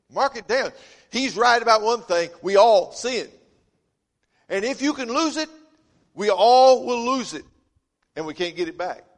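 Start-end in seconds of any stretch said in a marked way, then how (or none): 0.1-7.4 louder, higher, driving each point home; full-force preaching. Acted. Pastor pressing his congregation, loud preaching. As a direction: preach it hard, punching every line.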